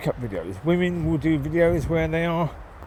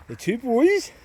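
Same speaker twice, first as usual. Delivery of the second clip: cooing; 10 semitones higher, 3 dB louder, slower than usual. Real, not acted. Repeat in a high cooing tone.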